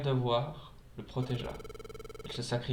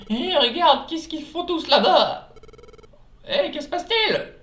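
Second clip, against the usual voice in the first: high, loud excited little creature; high cartoon voice; 7 dB louder than usual. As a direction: squeak it out like a cartoon critter. Voicing excited Pokémon character.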